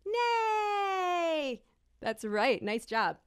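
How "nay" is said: a long high "Nay"; drawn-out nay. Shown as a demonstration. Teacher leads the children in saying no to the king, a long high "Nay". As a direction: hold out "Nay" up high for the kids to copy. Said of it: "Can everyone neigh with me".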